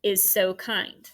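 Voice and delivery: slowly, "is so kind." as one smooth unit; one scoop phrasing. Shown as a demonstration. Teacher scooping a phrase for students, slow and deliberate. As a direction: glide through "is so kind." at a slow pace. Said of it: "one scoop".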